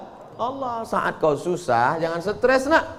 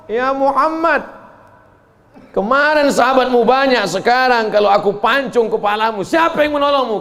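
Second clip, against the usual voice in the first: threatening enemy; 7 semitones higher, 3 dB louder than usual. Acted. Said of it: "kata Ibnu Mas'ud".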